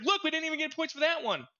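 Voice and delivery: high, disbelieving complaint; complaining to a teammate about missing points; exasperated. Retold or imitated. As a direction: whine at the game in disbelief. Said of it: "I was like".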